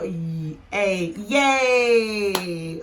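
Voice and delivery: "y", long "a", then loud stretched "yay"; segment, then cheer the blended "yay"; sounded out. Shown as a demonstration. Teacher blending yay for learners, sounded out with a cheer. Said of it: "You go".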